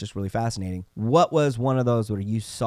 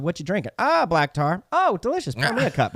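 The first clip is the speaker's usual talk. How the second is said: high, bright mock chatter between nurses; tossing off a cheeky joke to a coworker; mock coworker banter; 8 semitones higher than usual. Nurse joking with a coworker over coffee, mock cheery.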